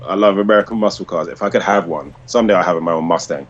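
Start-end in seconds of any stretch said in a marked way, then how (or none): none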